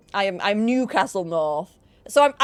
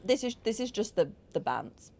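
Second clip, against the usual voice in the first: ordinary talk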